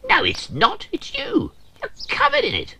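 very high, squeaky accusation; high, indignant squeak; accusing. Acted. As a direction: point out the smell. Character blames Cranky's smell.

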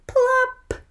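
a high, loud squeal of the sound word; high, loud plop for the little listeners; squeaky plop. Acted. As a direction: make the splash sound pop. Sound word read aloud dramatically.

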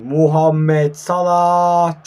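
loud, very slow name call; drawn-out commentator call. Real, not acted. Following Salah's attack in the game, excited drawn-out shout. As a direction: stretch the name out like a match commentator.

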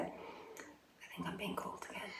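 hushed aside to herself; whispering. Real, not acted. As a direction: whisper so no one hears. Aside while someone calls her.

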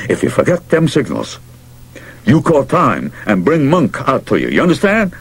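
insistent warning climbing high at the end; urgent. A narrator's reading. Manager briefing his pitcher on signals, urgently. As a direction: drill the instruction in, pitch rising with urgency. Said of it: "he said urgently".

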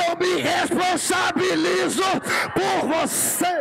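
shouted revival preaching; full-force preaching. Acted. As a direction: build the crowd up line by line. Litany rising to full preaching.

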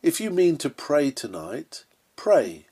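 ordinary talk, nothing marked

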